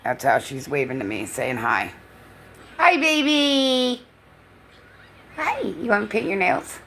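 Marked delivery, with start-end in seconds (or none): 2.8-6.8 high, slow coo. Real, not acted. Nana coos slowly to her dog. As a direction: stretch out a sweet hello.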